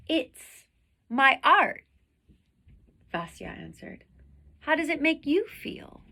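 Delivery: lively read-aloud storytelling voice; expressive children's story reading; story narration. A narrator's reading. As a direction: read it to the class like a picture book. Reads picture book aloud.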